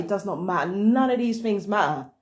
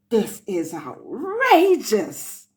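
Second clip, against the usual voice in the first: mock outrage; 3 dB louder, much slower than usual. Mocking the caller's complaint.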